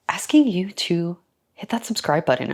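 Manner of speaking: playful whisper. Acted. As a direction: breathe the request secretly. Playful request to subscribe.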